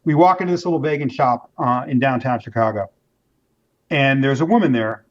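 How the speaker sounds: ordinary talk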